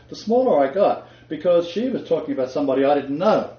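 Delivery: normal talking voice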